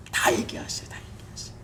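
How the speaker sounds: soft, unvoiced whisper; whispered appeal. Pastor gently urging the congregation to pray, whispered. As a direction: lean in and whisper it to them. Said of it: "whispered appeal".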